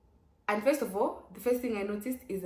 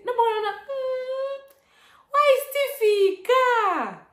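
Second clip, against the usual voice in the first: panicked; 9 dB louder, slower than usual. Retold or imitated. Panicking in the long Uber ride.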